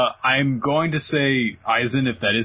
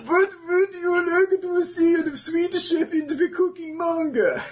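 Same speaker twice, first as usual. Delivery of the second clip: Swedish Chef voice. Acted. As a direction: stretch it out like the Muppet chef. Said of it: "my terrible Swedish Chef voice".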